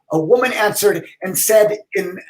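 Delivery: ordinary delivery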